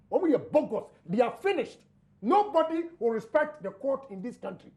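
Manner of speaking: normal talking voice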